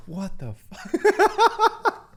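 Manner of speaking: very slow, high laughing what the fuck; a drawn-out, squeaky, outraged laugh-line; jealous girlfriend. Retold or imitated. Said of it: "Crypto's like".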